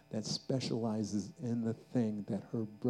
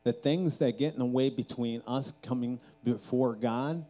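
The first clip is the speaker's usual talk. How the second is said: building, louder fervent altar call; preaching; 3 semitones higher, 6 dB louder than usual. Acted. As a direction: drive it up toward the climax.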